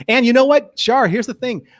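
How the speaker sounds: high, loud, indignant declaration; mock outrage. Cohost hams up outrage about their show. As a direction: play up the righteous outrage.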